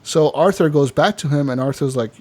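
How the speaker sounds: ordinary talk, nothing marked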